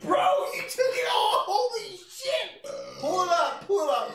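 high, loud laughing yell; excited shout. Real, not acted. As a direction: shout it in disbelief. Friend chugs mug.

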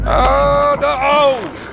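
loud high dismay; caught, dismayed cry. Real, not acted. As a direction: cry out as you get caught. Caught by the monster.

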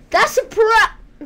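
high, drawn-out shout, cut off; exclaiming loudly. Real, not acted. Gamer spotting a problem in the level, loud outburst. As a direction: burst out loud and break off mid-word. Frustrated outburst during gameplay.

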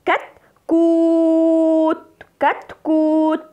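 "كتكوت" with a very long, high oo; stretched long vowel. Shown as a demonstration. Teacher showing pupils the madd, sustained oo in "كتكوت". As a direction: drag out the waw in "كتكوت" on one note. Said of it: "مد بالواو".

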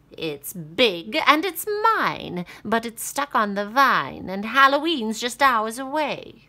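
high, loud cackling witch. Acted. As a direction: screech it like a witch. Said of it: "said the witch".

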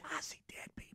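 voiceless spooky whisper; eerie whisper. Pretend. Joking about the wife's secret-sounding voice, in a whisper. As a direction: hiss it like a ghost secret. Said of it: "and she said".

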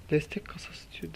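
breathy disappointed whisper of Destek kasası diyor. Real, not acted. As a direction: mutter it under the breath, deflated. Disappointed by another support crate. (fısıltıyla, hayal kırıklığıyla)

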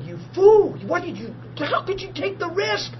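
high mock shout at the doctor; half-acted scolding yell; grandfather's mock outburst; retold angry yell. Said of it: "he yelled at him".

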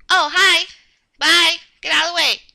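normal talking voice

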